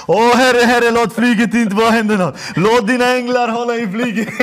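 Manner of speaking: loud, high pleading cry to God; panicked loud prayer. Retold or imitated. On a shaking plane, begging God in a loud high cry. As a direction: beg the Lord out loud as the plane shakes. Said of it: "Jag bara".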